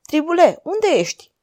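high-pitched worried call; searching, uneasy cry; anxious calling out. A narrator's reading. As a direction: call into the dark for someone missing. Anxiously calling missing companion.

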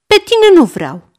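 put-on high, loud playful boy's refusal; cu humor. A narrator's reading. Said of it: "zice Goe cu humor". (cu umor, ștrengărește)

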